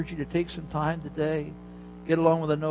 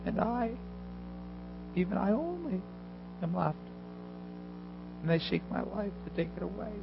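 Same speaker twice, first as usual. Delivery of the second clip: softer and a bit higher, confidence gone; less confident; 4 semitones higher, 6 dB quieter than usual. Acted. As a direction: Elijah answers God a second time, unsure and subdued. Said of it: "Not as much confidence this time".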